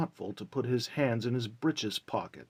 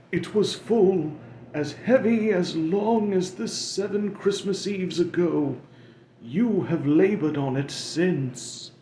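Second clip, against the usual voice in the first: higher, louder mournful ghost voice; Marley's wailing ghost; 9 semitones higher, 6 dB louder than usual. Acted. Marley's ghost lamenting to Scrooge, higher and mournful. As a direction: moan it like a doomed spirit.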